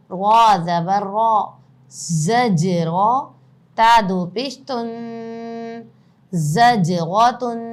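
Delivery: loud, slow letter-by-letter spelling; spelling drill. Shown as a demonstration. Teacher spells the word aloud for the class, slow and loud. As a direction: call out each letter and vowel for students to repeat.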